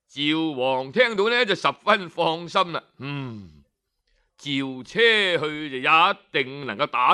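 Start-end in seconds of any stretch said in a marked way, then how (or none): none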